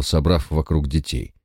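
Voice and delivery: usual speaking voice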